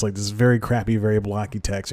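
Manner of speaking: ordinary talk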